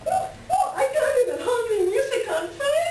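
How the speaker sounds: squealing high with laughter, almost crying; bubbling over with giddy joy; shrieking with delight at a new record; thrilled. Acted.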